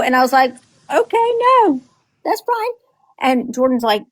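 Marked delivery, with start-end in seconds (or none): 0.9-2.7 very high, indulgent cooing; placating grandma. Retold or imitated. Grandma to protesting granddaughter, high-pitched soothing surrender. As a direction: give in sweetly in a high voice. Grandma gives in to upset child.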